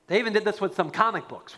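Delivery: ordinary talk, nothing marked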